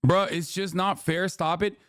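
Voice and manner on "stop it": ordinary delivery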